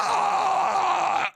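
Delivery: high shouted crowd roar. Retold or imitated. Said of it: "The crowd's like".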